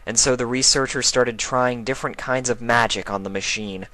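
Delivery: ordinary talk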